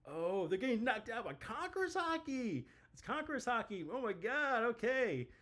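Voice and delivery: high, excited voice for Kaido in a panic; Kaido freaking out. Retold or imitated. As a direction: squeal it like a startled Kaido. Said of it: "Kaido's like".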